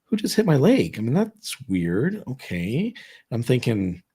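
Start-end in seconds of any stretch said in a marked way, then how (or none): 0.0-1.0 higher, puzzled startled question; confused alarm. Retold or imitated. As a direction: look around for who touched you. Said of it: "I'm looking around like".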